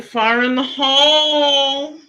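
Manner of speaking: slow, loud drawn-out call; mock call-out. Streamer calling out to viewers, slow loud warning. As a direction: bellow it like a warning before a blast.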